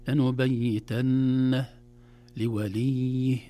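slow, drawn-out recitation with clear letters; Quranic recitation. Shown as a demonstration. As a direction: recite slowly in tajweed. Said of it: "هكذا". (تلاوة مجودة ببطء)

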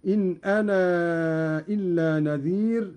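أنا with its final ā held long before إلا; separated madd. Shown as a demonstration. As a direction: stretch the end of أنا well out, then say إلا. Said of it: "مدا منفصلا هكذا". (مد منفصل في ألف «أنا» قبل «إلا»)

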